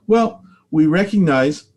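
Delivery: usual speaking voice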